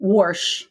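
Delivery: "Warsh" with an added r; dad's r-colored "Warsh"; Kansas City pronunciation. Retold or imitated. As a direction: put an r in wash, "Warsh". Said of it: "He pronounced, he's from Kansas City".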